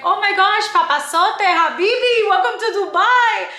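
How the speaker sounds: high, loud gushing tease; excited cousin. Retold or imitated. Cousin teasing about the cute guy on Instagram. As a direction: hype him up playfully. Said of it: "my cousin was like".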